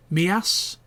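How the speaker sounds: Mias with no j sound, mee-ASS; local pronunciation. Shown as a demonstration. Naming the town they visited, local pronunciation of Mias. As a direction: say Mias as mee-AHS, the j silent. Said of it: "the locals call it Mias".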